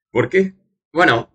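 ordinary talk

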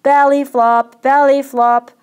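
high, very loud jeering call; full-voiced repeated shout. A narrator's reading. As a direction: yell it across the water at a diver. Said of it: "He shouted as Maurice came to the surface".

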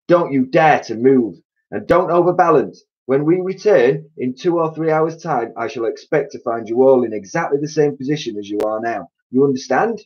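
high, loud stern command; ordering. A narrator's reading. Mister Twit ordering the monkeys before leaving. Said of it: "Mister Twit ordered".

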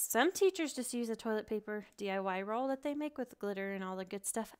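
normal talking voice

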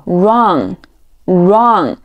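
"wrong" said slowly as "rong"; silent W. Shown as a demonstration. Teacher giving another example to learners, "wrong" with silent W. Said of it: "通常W不发音".

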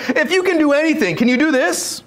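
high-pitched, imploring question; desperate plea. Retold or imitated. Father asks Jesus whether he can heal, desperate plea. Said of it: "You see the desperation in his voice".

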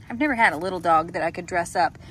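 ordinary delivery

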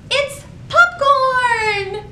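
high, slow, drawn-out cheer; triumphant high shout of popcorn; excited finale. Shown as a demonstration. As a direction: land the big reveal for the kids. Rhyme's big finish.

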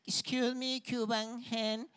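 put-on slow broken Spanish with an American accent; American hen mocking the Cuban hen, in an American accent; gringa hen accent. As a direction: talk down like a snooty gringa hen. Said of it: "le dice una de esas gallinas gringas".

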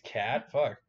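ordinary talk

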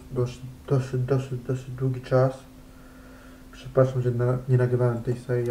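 ordinary talk, nothing marked

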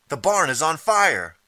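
high, loud, drawn-out cry of alarm; alarmed scream. Acted. Men on the roof sounding the alarm, screamed high and slow. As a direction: raise the alarm loudly across the settlement. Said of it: "are screaming".

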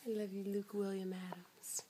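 tender. Real, not acted. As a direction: say it lovingly and softly. Tender to baby.

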